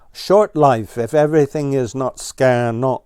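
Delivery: mean it as a threat; threatening. A narrator's reading. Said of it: "threatened Ground Squirrel".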